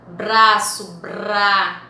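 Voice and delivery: "Braço", then "bra" alone with b and r joined; consonants pronounced together. Shown as a demonstration. Teacher showing consonants said together, "bra" in one piece. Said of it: "você pronuncia elas juntas".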